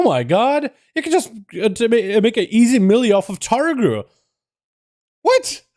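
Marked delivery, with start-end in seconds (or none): 5.2-5.6 sudden high-pitched squeak of disbelief; real startled what; shocked what. Real, not acted. Streamer shocked by raid sale price.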